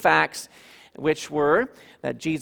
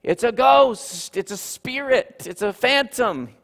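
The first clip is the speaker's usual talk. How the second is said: mock alarmed cry, higher and louder; frightened disciples; 8 semitones higher, 4 dB louder than usual. Disciples seeing Jesus appear, mock alarmed cry. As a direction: voice the disciples' startled fear. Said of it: "They said".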